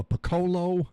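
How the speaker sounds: "piccolo" said puh-KOH-loh, high; mispronounced piccolo. Retold or imitated. Reading out her message, "piccolo" misread as puh-KOH-loh. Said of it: "it could be pronounced that way".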